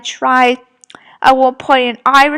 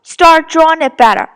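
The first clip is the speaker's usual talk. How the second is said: raised voice, mildly scolding; retold telling-off; 3 semitones higher, 5 dB louder than usual. Retelling how she criticized my drawing, scolding. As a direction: imitate someone telling you off, a bit louder. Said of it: "she flat out told me off".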